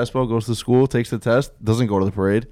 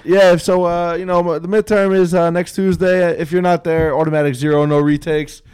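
raised, louder voice for a quoted teacher; teacher's announcement, pitched up; teacher laying down rules; 6 semitones higher, 5 dB louder than usual. Retold or imitated. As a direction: lightly imitate a teacher announcing test rules. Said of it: "She goes".

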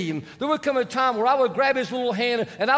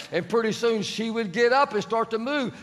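ordinary delivery

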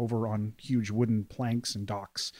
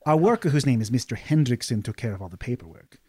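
lively, higher noble lady voice; a bubbly patron explaining herself; cheerful noblewoman Delilah; 4 semitones higher than usual. Acted. Delilah NPC explaining the quest.

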